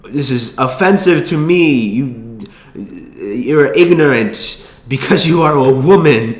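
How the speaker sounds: slow, emphatic mock foreign accent, taking offense; Tommy Wiseau. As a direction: badly imitate an indignant man's odd foreign accent. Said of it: "I can't even do his fucking accent".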